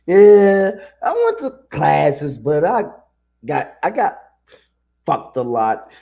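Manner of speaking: a hammed-up impression of a clueless student; mock dumb student voice; mocking college student. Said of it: "is just like".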